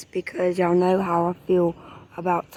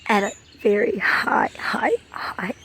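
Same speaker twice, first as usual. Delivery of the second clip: getting emotional; 5 semitones higher than usual. Real, not acted. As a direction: voice pitched up, welling with real emotion. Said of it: "I'm getting a little, um, emotional here".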